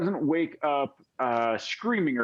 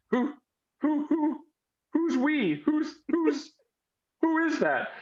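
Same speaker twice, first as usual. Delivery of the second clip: put-on high, stammering scared girl; small, bewildered and frightened, pitched way up; little girl voice; 14 semitones higher than usual. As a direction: stutter the questions in a high child voice. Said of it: "kind of a little girl voice".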